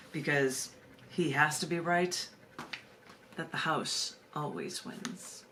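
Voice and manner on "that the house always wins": whispered punchline. Acted. Punchline of a read essay.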